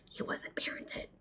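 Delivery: breathy whisper, dropping right down; a hushed, pointed aside. Real, not acted. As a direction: lean in and whisper the barb. Bitter aside about her husband.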